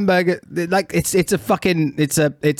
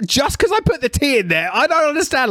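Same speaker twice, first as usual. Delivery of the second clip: high-pitched, incredulous complaint; exasperated protest; 12 semitones higher than usual. Retold or imitated. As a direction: retell it in exasperated disbelief, pitched way up. Retells exasperation at misunderstood water.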